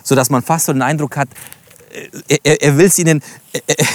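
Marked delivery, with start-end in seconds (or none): none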